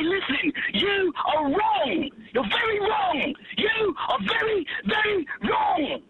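high, slow, exaggerated scolding; mock scolding call-in. Caller scolding the host on the phone, mock outrage. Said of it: "and then I call in and I say".

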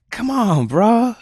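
very high, loud surfer whine; loud high laughing dude; laughing dude plea. Acted. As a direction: beg him to spill it. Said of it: "Julian laughed".

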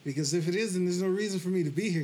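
loud, high, upset protest; retold yelling. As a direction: retell an angry challenge, starting high and forceful. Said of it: "I yelled at God".

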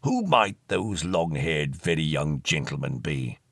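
inquire with antique formality; deep, ponderous elderly question; curious old ghost. Acted.

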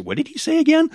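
high-pitched puzzled question; mock bafflement. Viewer rewinding David Tennant's dialogue, mock bafflement. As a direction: squeak it out, comically lost.

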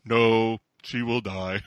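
low, loud pronouncement of doom; mock-ominous deep voice; Peter. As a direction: drop low and declare it solemnly. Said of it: "Peter's like".

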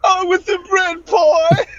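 high, slow mock British accent; joke British accent. Guest answering what he drinks with, mock British. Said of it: "beer can with a British accent".

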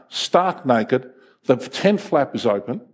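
normal talking voice

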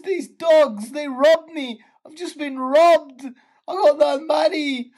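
high-pitched mock tearful wail; crying. Drunk woman tells cabbie she was robbed, crying. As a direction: bawl it out, drawn high like a drunk sob story. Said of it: "and she's crying".

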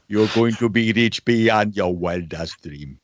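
put-on slow, grand promise in a Nigerian accent; Nigerian prince accent. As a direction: Nigerian scammer prince, drawing words out. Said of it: "you know the prince too".

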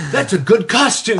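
slow, lightly voiced old man; a hint of a slow old-timer; mock old man. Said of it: "he's just like".

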